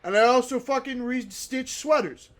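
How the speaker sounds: high, cocky knitting braggart; cool person. Acted. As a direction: brag like the hotshot of craft class. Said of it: "trying to be the cool person".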